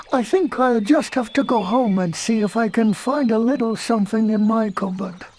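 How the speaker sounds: high hungry bear voice; high cartoon bear, wistful; Pooh voice. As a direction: play Pooh, hopeful about honey.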